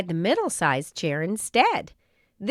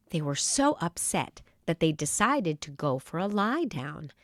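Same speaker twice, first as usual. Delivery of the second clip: usual speaking voice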